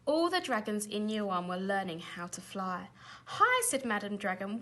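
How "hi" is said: high, loud call from the sky; bright teacher's hail. A narrator's reading. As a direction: call down to the pupils. Teacher dragon calling up to class.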